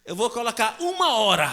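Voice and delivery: loud, emphatic mock decree; decisive mother. Mother shortens the punishment, decisive declaration. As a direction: announce the new sentence loudly.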